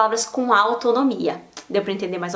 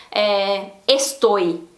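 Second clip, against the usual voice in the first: usual speaking voice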